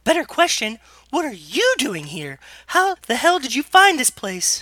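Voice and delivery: high, loud put-on girl's voice; indignant Ellie falsetto. Younger sister challenging her sister, in indignant falsetto. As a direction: argue back in loud falsetto. Man voicing Ellie arguing back.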